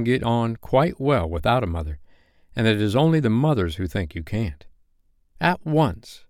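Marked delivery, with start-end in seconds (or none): 5.4-6.2 higher-pitched, resolute reply; mildly acted resolve. A narrator's reading. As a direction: answer firmly, without hesitation. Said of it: "Wendy replied resolutely".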